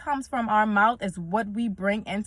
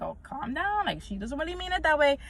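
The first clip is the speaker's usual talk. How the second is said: lighter, softer self-soothing voice; calming self; 6 semitones higher, 5 dB quieter than usual. Retold or imitated. Telling herself to calm down, higher and softer. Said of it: "I had to calm myself down".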